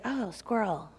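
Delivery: a zombie drifting off to something new; mock zombie distraction, barely voiced; distracted zombie. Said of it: "then it's like".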